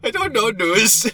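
mocking repeat.

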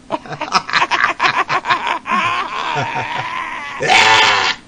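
big burst of high laughter; cracking up loudly at the joke. Real, not acted.

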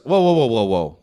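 loud string of whoas, falling; acted interruption. Bartender stopping a customer's joke, acted loud interruption. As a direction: cut in loudly to halt the joke.

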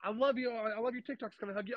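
mock fangirl excitement; starstruck fan. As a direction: gush as if spotting a celebrity. Imitates fan meeting speaker.

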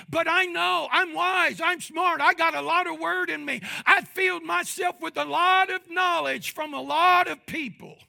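loud, high boastful know-it-all voice; mock know-it-all. Preacher mocking a proud listener, loud boastful voice.

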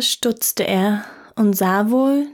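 ordinary delivery

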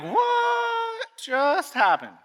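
high, very slow stunned cry; flabbergasted. Retold or imitated. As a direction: drag it out in disbelief. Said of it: "I'm like".

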